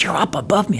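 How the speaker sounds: softly imitated answer; hushed reply. Hidden man to searcher above, hushed reply. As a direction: keep it down, almost whispering.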